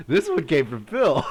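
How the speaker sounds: loud, high words through laughter; real laughter breaking up the words; laughing hard. Real, not acted. Streamer naming Phil as letter writer, laughing.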